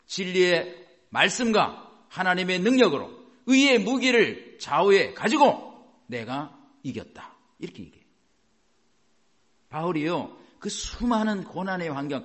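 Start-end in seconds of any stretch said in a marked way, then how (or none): 0.0-7.4 loud, punched phrases with pauses; emphatic preacher quoting a victory cry; triumphant declaration. Retold or imitated. Voicing Paul's declaration of victory.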